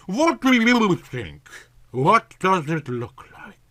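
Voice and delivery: a hammed-up shout, high at the start, then easing off; bellowing mule. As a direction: bray it back like an irritated mule. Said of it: "shouted back like a bellowing mule".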